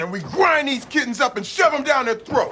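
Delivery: fired-up shout. Acted. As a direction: yell it as a battle cry. Rallying cry.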